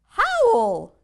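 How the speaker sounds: high, loud cried word; werewolf mom's cry. Acted. Werewolf mother cries out to her pups, loud. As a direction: call it like a werewolf mom. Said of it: "cried the ma".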